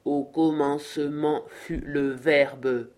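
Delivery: slow, low machine voice, every word drawn out; howling machine. A narrator's reading. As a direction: intone it like a speaking machine, low and laboured. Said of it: "hurla la machine".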